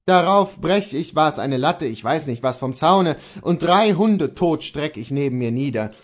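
ordinary delivery

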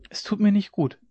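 put-on high child's voice, mildly fearful; a small child's timid protest, pitched high; trembling. A narrator's reading. Scared child to father by the sea, trembling. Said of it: "sagte sie zitternd".

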